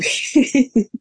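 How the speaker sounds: bright burst of laughter at the memory; real laugh. Real, not acted. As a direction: laugh out at the funny story. Laughing at the Nell Carter story.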